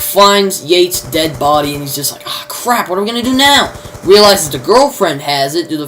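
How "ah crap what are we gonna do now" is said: mock dismay. Acts out Jack finding a body.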